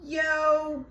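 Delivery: imitated yell across an office; retold yell. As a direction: call out like hailing a coworker, imitating him. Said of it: "he yelled".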